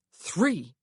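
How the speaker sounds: loud, mildly furious single word; a touch of held-in outrage; barely contained fury. A narrator's reading. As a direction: bite back anger on one word. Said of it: "his fury hardly contained".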